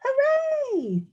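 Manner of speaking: high cheer called back from afar; echo shouting back. Acted. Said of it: "the echo shouted back".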